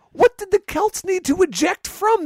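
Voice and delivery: loud, very high-pitched disbelief; incredulous outburst. Real, not acted. Film critic ranting at a co-host about a plot hole, incredulous outburst. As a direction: cry out at the sheer absurdity.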